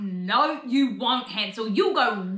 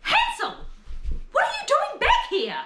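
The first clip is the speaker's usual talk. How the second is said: shocked shout. Acted. Stepmother sees Hansel come home, high shocked yell. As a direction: burst out in alarm at his return.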